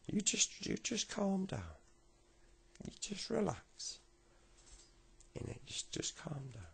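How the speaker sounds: fussing granddad. Acted. Granddad tells Tommy to wipe his tears, high soothing. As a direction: coax him down in a high granddad voice. Granddad calms crying boy.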